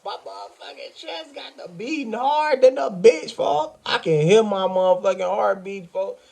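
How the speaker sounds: high-pitched panic, heart racing; freaking out, voice jumping way up; panicked. Retold or imitated. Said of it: "I'm like".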